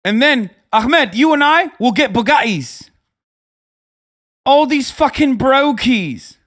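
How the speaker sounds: high, loud swaggering wannabe-tycoon voice; mock Taliban hustler. Streamer voicing a Taliban fighter pitching heroin to Ahmed, loud swagger. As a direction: ham up a cocky get-rich bro pitching a scheme. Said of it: "they're like".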